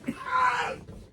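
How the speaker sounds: very high, wailing yawn; high yawning shout. Retold or imitated. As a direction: let the yawn out as a cry. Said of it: "like, go like".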